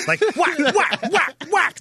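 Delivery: high, loud laughing whack whack sound effect; imitated stick whacks. Host mimics old player hacking kids with stick, laughing whacks.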